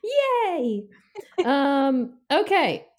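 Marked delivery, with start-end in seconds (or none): 0.0-0.8 cheering. Real, not acted. Celebrating a correct answer.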